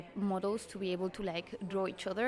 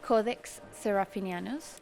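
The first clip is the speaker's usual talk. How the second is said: Codex Serafinianus said slowly and distinctly; slower on request. Shown as a demonstration. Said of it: "could you say it slower".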